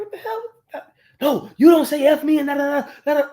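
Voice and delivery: high, shocked outburst at her; retelling how he blew up at his girlfriend, high and outraged; indignant disbelief. Retold or imitated. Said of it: "And I'm like".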